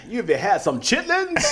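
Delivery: high, amused American slang voice; put-on American accent. Russian showing off American slang, put-on American accent. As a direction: ask it in a hammed-up American accent. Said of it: "an American accent".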